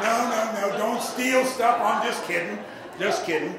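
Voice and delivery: loud, high mock alarm at stealing; loud joking protest. Real, not acted. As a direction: shout it out as a joke. Joking he's just kidding.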